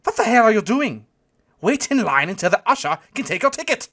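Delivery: put-on high, loud German-accented scolding; German calling out. A narrator's reading. German doorman to a queue-jumper, loud accented shout. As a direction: yell it out as an indignant German. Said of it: "the German called out to me".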